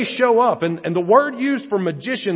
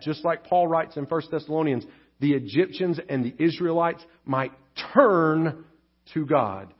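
usual speaking voice

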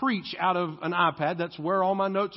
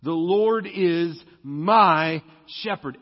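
slow, weighted "my"; stress on my; 5 dB louder, slower than usual. Shown as a demonstration. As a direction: land deliberately on "my".

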